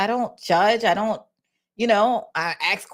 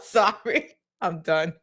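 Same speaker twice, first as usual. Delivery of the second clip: usual speaking voice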